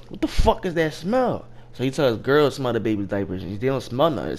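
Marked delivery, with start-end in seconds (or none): 0.1-1.5 high-pitched mock outrage; retold anger. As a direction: demand it, imitating a man getting madder. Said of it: "He getting mad".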